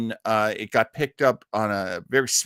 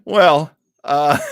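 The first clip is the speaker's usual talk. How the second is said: broken laugh with a tearful edge; half laugh, half cry; laughing through it; 3 semitones higher, 9 dB louder than usual. Real, not acted. Host recovering from losing the role, laughing helplessly. Breaks up after lost role.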